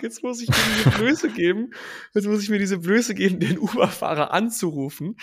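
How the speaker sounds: hammed-up dread of calling back; mock despair. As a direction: groan it like it's the worst fate.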